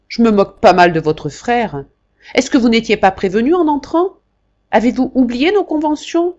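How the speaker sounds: loud, high scolding; the marquis scolding Jacques over their agreement; angry old marquis. Acted. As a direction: berate him without mercy.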